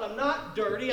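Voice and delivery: ordinary talk, nothing marked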